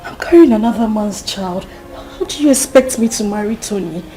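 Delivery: loud, frantic begging; desperate pleading. Acted. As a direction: beg her with everything you have. Pregnant girl begging not to marry.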